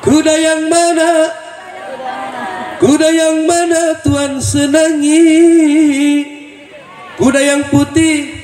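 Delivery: lifted, ringing preacher's call; high preaching call. Acted. Preacher calls out high and loud.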